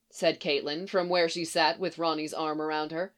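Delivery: usual speaking voice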